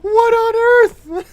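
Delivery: loud, stretched shriek of shock; shocked shout. Real, not acted. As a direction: yell it in sheer disbelief. Shocked outburst at game.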